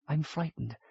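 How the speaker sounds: frightened whisper to Binabik. A narrator's reading. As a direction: breathe it in the dark. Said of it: "she whispered".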